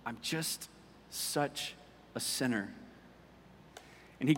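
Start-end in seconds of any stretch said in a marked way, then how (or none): 0.0-2.7 soft, near-whispered broken confession; mild retold sob. Young man admitting guilt to his friend, mildly tearful. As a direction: barely get the words out, half-breathed. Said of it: "And through sobs".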